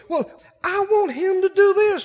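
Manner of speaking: high imitated lady's voice; complaining wife. Voicing a lady in counselling.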